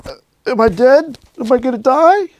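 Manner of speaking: high, strained squeak of panic; mock terror. After the strike to friend, high mock panic. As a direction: play a man sure he's dying. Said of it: "And I was like".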